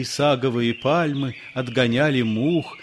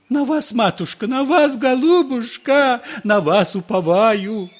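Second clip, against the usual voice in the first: grovel and plead; fawning priest; 11 semitones higher, 7 dB louder than usual. A narrator's reading. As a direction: priest on his knees imploring the lady. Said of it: "зачастил".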